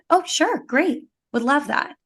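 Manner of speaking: bright, high, eager yes; sing-song happy acceptance of help. Retold or imitated. Acting out accepting offered help.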